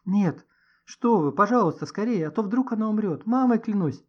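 urgent, high and loud; a pleading outburst, pitched high; blurted plea. A narrator's reading. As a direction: beg them in a rush of alarm. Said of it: "вырвалось у него".

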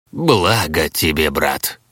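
low drone muffled inside a helmet; из-под шлема. A narrator's reading. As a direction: boom it out from under a helmet. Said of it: "Прогудел из-под шлема воитель". (гулко, из-под шлема)

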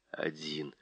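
whispered, unvoiced, trailing off. A narrator's reading. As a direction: breathe the last word. Narration fading out.

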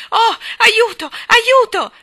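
very high, loud scream; panicked cry for help. Acted. Jane struggling in iron arms, screaming for help. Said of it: "gridò".

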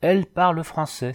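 astonishment building, pitch climbing each time; stupefied. A narrator's reading. Dragoon discovers she speaks his language, growing stupefied. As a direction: climb from baffled question to amazed exclamation. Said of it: "d'un ton aussi stupéfait".

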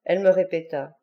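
ordinary delivery

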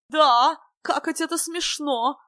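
high, slow, drawn-out wonder; silly mock profundity. A narrator's reading. Silly guest pronounces on the joke, high slow drawl. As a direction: draw it out like a clownish philosopher. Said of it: "глубокомысленно изрёк Дурилов".

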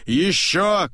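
high short call; angry shout. A narrator's reading. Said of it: "кричит он". (злой короткий окрик)

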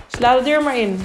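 loud, cocky challenge; reckless bravado. A narrator's reading. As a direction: dare them with overconfident bravado. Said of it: "riep hij overmoedig".

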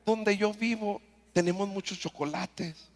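tender refusal. Retold or imitated. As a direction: coax the child kindly, slowing down.

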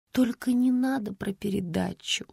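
whispered plea; pleading. Acted. Daughter begs her mother to skip the TV story, whispering. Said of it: "взмолилась Света".

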